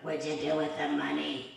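low, quick, put-on official's voice; IRS caller impression. IRS agent phones preacher about debt, low imitated demand. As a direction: demand an answer as the tax caller. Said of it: "That's how she sounded".

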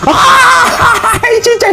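bursting out laughing; laughing shout. Real, not acted. Laughing in the game.

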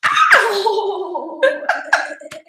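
high-pitched burst of laughter; sudden helpless high laugh; real laugh. Real, not acted. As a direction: crack up mid-reading. Reader bursts out laughing mid-story.

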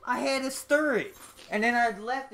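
high, loud and defensive; high-pitched. Real, not acted. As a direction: whine it out high. Defending himself.

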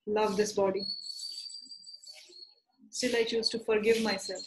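measured statements, spaced out for her to repeat; guided tapping affirmation. Acted. Therapist leading Neha through tapping statements, steady guiding voice. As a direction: lead the setup phrases in a steady guiding voice. Leads EFT setup statements for repetition.

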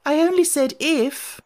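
very high, plaintive protest; piteous pleading. A narrator's reading. Poor Alice defending herself to the queens, piteous. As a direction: plead pitifully, pitched right up. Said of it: "pleaded in a piteous tone".

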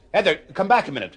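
loud, fast call after someone leaving. Acted. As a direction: call her back before she's gone. Calling after her as she leaves.

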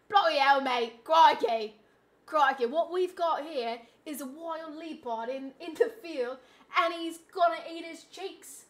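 hammed-up Australian wildlife presenter; badly faked Australian twang; Australian accent. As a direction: narrate a sighting in a fake Aussie accent. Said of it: "Actually, that was Australian".

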